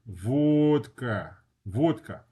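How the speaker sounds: "vodka" with a long rounded O, VOHD-ka; Russian "vodka", stressed round O. Shown as a demonstration. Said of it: "example of a stressed O".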